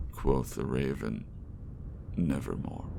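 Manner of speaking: theatrical recital with swelling passion and hushed ends; dramatic poem reading. Acted. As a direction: recite it like a stage performance. Reads The Raven aloud.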